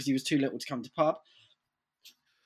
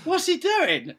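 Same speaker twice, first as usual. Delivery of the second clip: much higher incredulous question; mock bewilderment; slower than usual. Skate team watching their shouting friend, mock bewilderment. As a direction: imitate baffled onlookers. Voices group baffled by friend.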